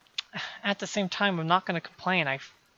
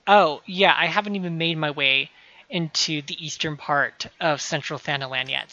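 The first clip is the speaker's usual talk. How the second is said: ordinary talk, nothing marked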